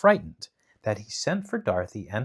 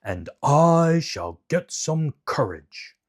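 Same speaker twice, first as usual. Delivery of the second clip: slow, changed-timbre Lion wishing for courage; lightly voiced timid Lion; 5 semitones higher, 5 dB louder, slower than usual. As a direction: drag the words like a timid lion. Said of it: "said the Lion".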